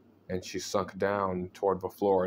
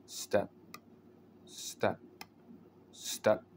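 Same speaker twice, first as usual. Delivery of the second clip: soft, creeping footsteps; quiet; 6 dB quieter, much slower than usual. A narrator's reading. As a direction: let each step fade to a hush. Ghost's slow creeping footsteps.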